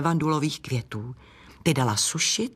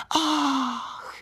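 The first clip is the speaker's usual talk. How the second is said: high, airy sighed "ach"; long sigh; 9 semitones higher than usual. A narrator's reading. Camellias caught by the wind, sighing. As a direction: let out a trailing sigh. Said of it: "a ty jen vzdychly".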